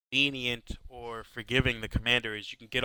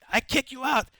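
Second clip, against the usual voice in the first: angry cab driver; 12 semitones higher, 6 dB louder than usual. Retold or imitated. German cab driver screaming at them.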